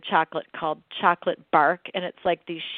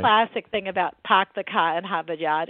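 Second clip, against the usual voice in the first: usual speaking voice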